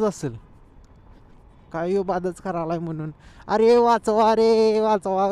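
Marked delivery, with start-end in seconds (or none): 3.5-5.3 slow, loud mock cry for help; oil seal crying for help. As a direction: wail for rescue like a dying engine part. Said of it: "करत असेल हे नाही oil seal".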